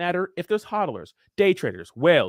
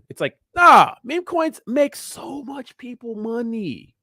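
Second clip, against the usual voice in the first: fired up; 7 semitones higher, 5 dB louder, slower than usual. Real, not acted. Fired-up retort to critics.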